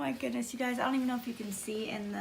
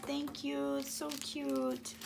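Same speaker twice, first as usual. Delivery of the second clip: normal talking voice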